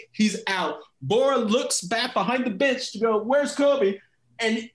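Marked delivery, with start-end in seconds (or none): 3.2-4.0 calling out. Retold or imitated. Coach looking for sub.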